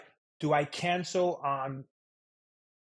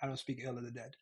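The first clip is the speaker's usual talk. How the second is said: low, soft deadpan sarcasm; understated mock solemnity; sarcastic; 4 semitones lower, 10 dB quieter, faster than usual. Shown as a demonstration. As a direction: drop it quietly, as a dry joke. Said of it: "in a real sarcastic way".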